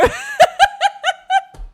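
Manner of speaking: shrieking burst of laughter; real belly laugh; loud high laugh. Real, not acted. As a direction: cackle out loud, high and bright. Streamer cracking up.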